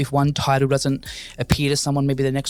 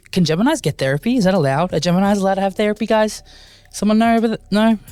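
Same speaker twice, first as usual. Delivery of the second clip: excited high questions; 6 semitones higher, 3 dB louder, faster than usual. Real, not acted. Excited asking the audience.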